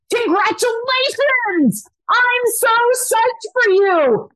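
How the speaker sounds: high, slow, beaming burst of congratulations; excited congratulations. Acted. Coach congratulating a struggling viewer, high and excited. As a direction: cheer them on like big news.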